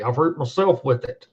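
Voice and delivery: usual speaking voice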